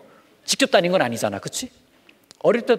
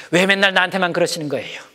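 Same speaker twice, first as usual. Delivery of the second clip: talking back; 5 semitones higher, 4 dB louder than usual. Acted. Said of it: "꾸중하실 때".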